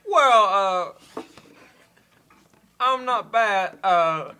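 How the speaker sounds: a light, halting Walken impression; mild Walken cadence, choppy and deliberate; Christopher Walken. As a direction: pause oddly between phrases like Walken. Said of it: "Christopher Walken".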